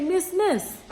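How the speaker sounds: high-pitched nanny calling the teacher; imitated nanny.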